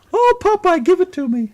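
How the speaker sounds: very high squeaky falsetto; cartoon woman voice. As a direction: swoon in a tiny cartoon falsetto. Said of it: "You like 'em to sound like Minnie Mouse?".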